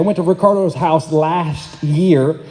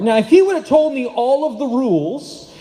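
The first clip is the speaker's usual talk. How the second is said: ordinary talk, nothing marked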